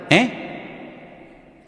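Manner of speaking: breathy whispered stalling eh; whispered mock huh; mock evasive eh. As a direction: play dumb to buy time. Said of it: "tú le preguntas a alguien algo y te dice".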